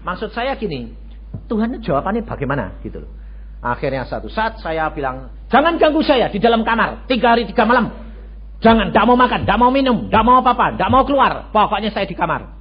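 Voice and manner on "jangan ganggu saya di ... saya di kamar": louder, higher insistent declaration; loud defiant vow. Retold or imitated. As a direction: declare it stubbornly. Retold vow of fasting. (sumpah keras kepala)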